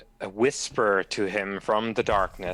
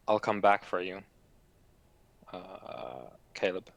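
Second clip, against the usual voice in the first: whisper; 2 semitones lower, 5 dB quieter than usual. Acted. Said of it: "I whisper to him".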